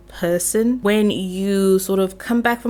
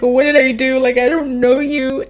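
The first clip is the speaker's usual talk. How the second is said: bewildered player; 5 semitones higher, 5 dB louder than usual. Retold or imitated. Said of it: "you're still just like".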